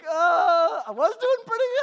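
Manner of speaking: high, laughing mock-nervous reply; laughing retelling. Pastor retelling his caught dad, laughing high.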